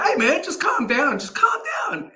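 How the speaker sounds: high, rising playful teasing to a friend; mock teasing. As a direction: wind up a mate with a sing-song. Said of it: "And I was like".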